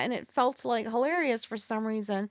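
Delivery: normal talking voice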